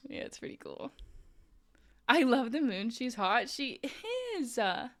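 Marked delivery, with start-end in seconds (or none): 0.1-0.9 whispered. Retold or imitated. Quoting her own embarrassed reply.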